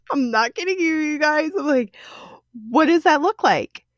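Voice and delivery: high, pleading exasperation; half laughing, half crying in frustration; exasperated. Real, not acted. Frustrated outburst, pitch high.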